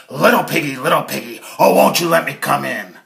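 loud, coaxing big bad wolf; sly wolf. Wolf knocks on Harold's door, loud and coaxing. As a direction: lure the pig out, loud and sweet. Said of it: "banged on Herman's door and said".

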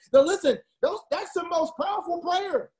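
high, fired-up preacher voice; impassioned sermon peak; passionate preaching. Real, not acted. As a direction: preach it with real passion, pitch way up. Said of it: "Listen, I'm preaching right now".

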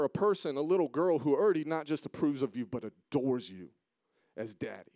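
preaching. Acted. Pastor confronting himself before the congregation, preaching at full force. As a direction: drive each question home harder. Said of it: "preacher questions himself with rising force".

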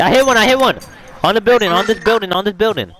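high excited gamer callout. Real, not acted. Excited callout after hit.

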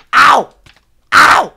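pained yelps. Real, not acted. Getting hit in game.

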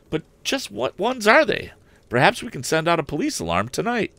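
a keen lad pressing his father; eager young Tom. Acted. Tom questions his father about the theft, high and eager. Tom's voice again.

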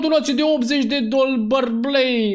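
high cry tumbling into babble; excited shout. Real, not acted. Streamer seeing an eighty-dollar donation, excited shouting. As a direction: shout it high until the word garbles.

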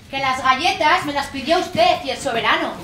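ordinary talk

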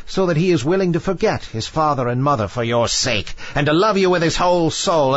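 high, insistent witch voice; high-pitched witch pronouncing doom, easing at the end; sea witch, dire warning. A narrator's reading. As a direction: pile up the threats as a witch. Witch warns of the price.

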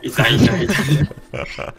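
slow drawn-out mock chant; 模仿野队友. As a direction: lightly mimic a spammy teammate. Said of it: "他们就这样". (拖长声调模仿乱闪的野队友)

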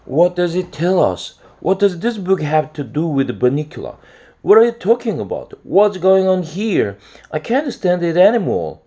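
high, loud, frantic string of questions; exasperated near-scream. A narrator's reading. Harold begs Chester to explain the book, nearly screaming. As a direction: demand answers at the end of patience. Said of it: "I practically screamed".

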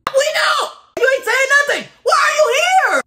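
high, angry yelling at the silent contestant; exasperated shout. Real, not acted. Streamer yells at the silent contestant, exasperated. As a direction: shouts at her in frustration. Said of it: "I'm angry at the wrong person".

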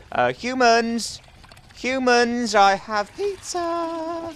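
high, slow, stretched-out call; singsong luring call. Pretend. Hunter luring humans with pizza, singsong call. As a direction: lure them out, rising very high at the end.

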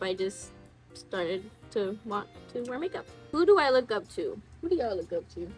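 ordinary talk, nothing marked

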